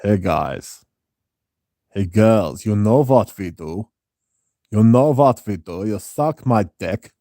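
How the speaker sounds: mock Germanic impression, emphatic and deliberate; hammed-up Prussian sleazy character; Jeremy Fragrance. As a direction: put on a general Prussian accent. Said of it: "Do Jeremy Fragrance".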